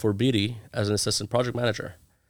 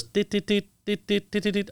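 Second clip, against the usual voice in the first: quick higher tit tit tit like ticking Morse code; imitated bullet pings; 11 semitones higher than usual. Sailor imitates bullets hitting the ship, ticking pings. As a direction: tap it out like bullets pinging off steel. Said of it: "All I heard was".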